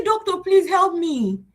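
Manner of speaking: high, drawn-out mock plea; pleading. Patient begging a doctor for help, pleading.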